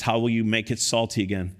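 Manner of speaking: ordinary delivery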